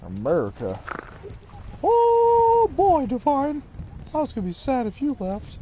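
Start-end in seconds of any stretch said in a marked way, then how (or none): 1.8-3.6 put-on squeaky, loud falsetto "oh boy"; mock squeal. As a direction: shriek it like a delighted cartoon. Teasing exclamation to friend.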